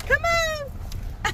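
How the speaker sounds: high, stretched-out coaxing call; calling pets. Real, not acted. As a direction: call the animals in, voice lifted high. Calling the animals over.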